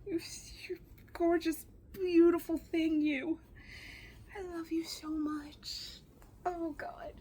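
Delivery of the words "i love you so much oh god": tearful whisper. Real, not acted. Whispering to the dress.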